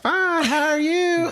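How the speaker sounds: very high, slow, drawn-out Southern twang; sudden Southern accent. Retold or imitated. Co-host mimicking woman slipping into her accent, hammed-up Southern drawl. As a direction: overdo a high, drawling Southern belle reply. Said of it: "and it's like instantly".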